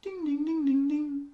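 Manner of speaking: high sung ding, ding imitating metal; high ding sound. Host imitating a harp being sharpened, very high dings. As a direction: ring out each ding very high.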